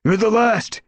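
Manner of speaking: loud, high yell. A narrator's reading. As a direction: yell over the noise. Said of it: "he yelled".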